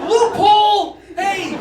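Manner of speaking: triumphant yell. Real, not acted. To the audience, a loud, laughing triumphant yell. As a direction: crow it out in triumph. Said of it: "triumphant shout".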